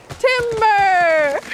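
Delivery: long loud drawn-out timber; yelling. Pretend. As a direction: shout it as you fall over. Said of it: "You have to yell".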